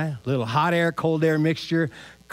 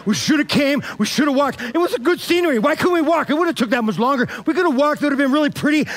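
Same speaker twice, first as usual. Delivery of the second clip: whiny complaint; 6 semitones higher than usual. Retold or imitated. Thomas grumbling about walking.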